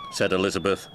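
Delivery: ordinary delivery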